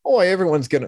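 high, lightly put-on accent that slips to Australian; trying for Irish, landing in Australia; failed Irish accent. Podcast host attempting [name] for his co-host, lightly voiced Irish accent. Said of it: "no, that's Australian".

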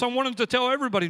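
ordinary talk, nothing marked

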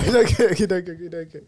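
chanting it through laughter; laughing taunt, over and over; laughing teasing. Real, not acted. Teasing friend while laughing.

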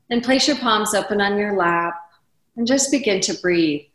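usual speaking voice

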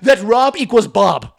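high, loud accented mock rant; hammed-up outrage in a put-on accent; Hassan freaking out. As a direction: imitate an exasperated coworker losing it. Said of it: "He's freaking out".

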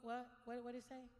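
usual speaking voice

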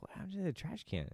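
high-pitched mock mom voice; imitated mom. Son retelling mom finding the trash can, high-voiced puzzlement. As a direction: go up into a light falsetto question. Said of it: "My mom had walked in there. She's like".

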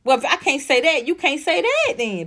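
ordinary talk, nothing marked

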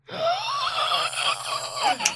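huge high gasp. Real, not acted. Hearing the boss mock her mother, a big shocked gasp. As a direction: gasp as if deeply offended.

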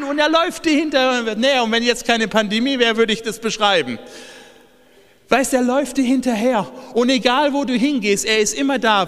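fervent revival preaching; preaching at full force. Acted. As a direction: preach it out to the whole church, building to a shout. Preaching continues.

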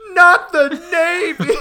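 high, slow laughing cry; Squidward voice. Retold or imitated. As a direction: wail it at the camera. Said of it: "He turns to face the camera".

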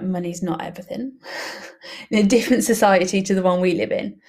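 ordinary talk, nothing marked

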